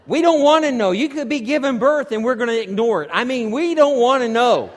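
loud, high, emphatic insistence; emphatic exclamation. Real, not acted. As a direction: belt it out as a comic protest. Joking emphatic disclaimer for all men.

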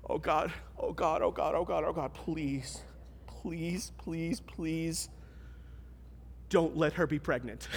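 low, hushed, frantic begging to God; desperate foxhole prayer. Retold or imitated. A worshipper in a foxhole, pleading in hushed panic. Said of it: "Foxhole prayers go like this".

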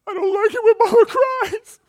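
high, loud tearful child imitation; little daughter crying. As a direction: cry it out like a small girl. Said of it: "Crystal says".

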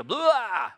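loud disgusted "Blah!" spat out; hammed-up gagging noise; mock retch. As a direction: spew it like something ugly coming up.

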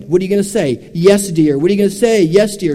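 retold rapid-fire chant, rising in force; getting worked up. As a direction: build it up like a coach firing up a trainee. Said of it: "And so he got me going".

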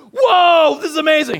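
high, loud mock wow; hammed-up amazement. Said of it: "It's like".

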